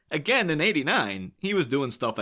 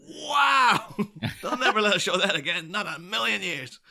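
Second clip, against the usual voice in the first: Krusty; 8 semitones higher than usual. Retold or imitated. Co-host likens a disastrous episode to Krusty's reaction, mock-stunned. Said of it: "that was like Krusty coming out".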